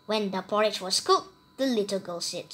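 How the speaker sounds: usual speaking voice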